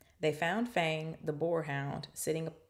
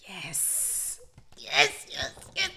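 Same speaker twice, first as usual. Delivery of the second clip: excited; 3 dB louder, much slower than usual. Real, not acted. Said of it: "I am so happy".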